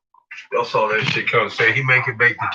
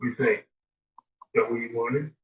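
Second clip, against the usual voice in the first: ordinary delivery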